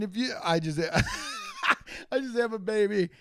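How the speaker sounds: ordinary talk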